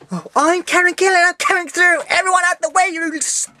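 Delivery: high, haughty mocking voice of a pampered celebrity; mock snooty star. Fan mocks the star who pushed past her, high haughty diva voice. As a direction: sneer it out like a diva sweeping past. Mocking the actress's grand entrance.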